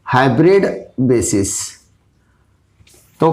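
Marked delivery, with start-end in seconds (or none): 0.1-1.8 slow dictation. Shown as a demonstration. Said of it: "जिसे कुछ लोग हाइब्रिड बेसिस भी कहते हैं".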